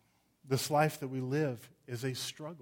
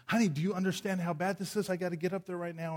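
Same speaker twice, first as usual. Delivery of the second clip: panicked plea; 6 semitones higher, faster than usual. Retold or imitated. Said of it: "I'm going".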